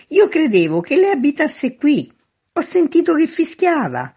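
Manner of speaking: high, loud protesting call; shouting down. A narrator's reading. Man at window explains to the whistler, shouting. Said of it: "gridò il signor affacciato".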